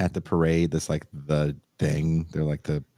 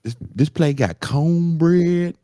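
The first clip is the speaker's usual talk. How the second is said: slow, loud, high mock Southern accent; exaggerated put-on Southern drawl; mock her fake Southern accent, dragging it out; imitated put-on accent; 10 semitones higher, 6 dB louder, slower than usual.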